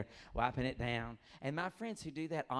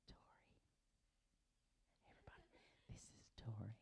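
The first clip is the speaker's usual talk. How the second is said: soft mic intro; 3 semitones lower, 20 dB quieter, faster than usual. Real, not acted. Introducing shy audience member.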